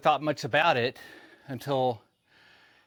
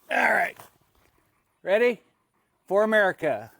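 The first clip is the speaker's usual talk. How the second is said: pumped up; 5 semitones higher than usual. Real, not acted. Starting push-ups, rallying viewers.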